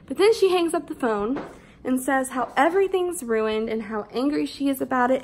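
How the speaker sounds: usual speaking voice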